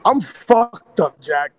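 worn-out hoarse voice, slow; barely any voice left after no sleep. Real, not acted. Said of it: "Uh, no voice".

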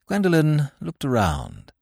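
ordinary delivery, nothing marked

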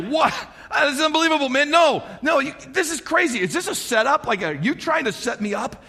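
retold outburst of shocked disbelief; distraught. Preacher acting out the king reacting to the letter, mock-distraught. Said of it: "the king got distraught".

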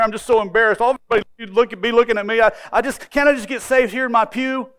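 high, loud tearful woman's plea; pleading woman. Retold or imitated. Preacher voicing the embarrassed woman's plea, high and pleading. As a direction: voice the embarrassed woman begging to stay put.

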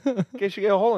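fast, high, laughing repeat. Real, not acted. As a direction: rush the line high through laughter.

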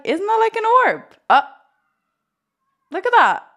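thrilled, pointing it out; excited. Real, not acted. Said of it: "But today I'm excited".

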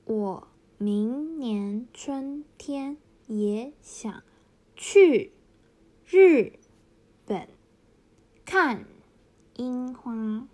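slow reading, 去 日 看 punched loud and falling; fourth tones emphasized. Shown as a demonstration. Teacher reading the example sentence, fourth tones stressed loud. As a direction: read slowly, hammer each falling tone on 去 日 看. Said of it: "例文の中の四声を強調して読んでいきます".

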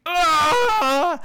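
sudden high pained cry; agonized scream. Acted. Vanya crying out as her implant burns. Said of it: "she drops to her knees".

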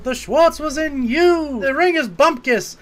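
high-pitched mentor impression; Yogurt. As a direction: exclaim it in a high put-on mentor voice. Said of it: "you hear Yogurt's voice".

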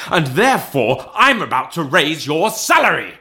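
spring the good news on him; triumphant reveal. A narrator's reading. Scrooge's surprising good news.

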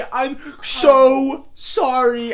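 high, very slow drawn-out fake apology; mock apology. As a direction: overplay a sappy sorry.

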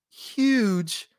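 ordinary delivery

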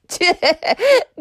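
high mischievous cackle; ferret's playful cackle. Acted. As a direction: cackle at the joke you just pulled. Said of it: "Funny Ferret cackled".

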